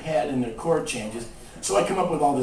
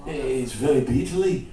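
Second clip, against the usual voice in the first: [name]; 3 semitones higher, 4 dB louder than usual. Retold or imitated. Rock singer praising a songwriter's song, stammering caricature. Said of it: "He goes".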